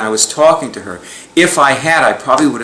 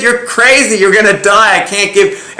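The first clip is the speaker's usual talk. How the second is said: half-yell the doctor's alarm, pitched up; retold screaming; 8 semitones higher than usual. Doctor scolding his patient for dropping her meds, high mock yell. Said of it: "my doctor screamed at me".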